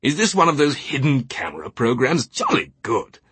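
high, hammed-up English gentleman, tickled; amused outburst. A narrator's reading. Teabing takes the captain's charge for a prank, highly amused. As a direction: treat it all as a great joke. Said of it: "then burst out laughing".

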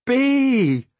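high, drawn-out exclamation; exclaimed. A narrator's reading. Lant spots Bee as he reaches her father, exclaiming. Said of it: "Lant exclaimed as he came".

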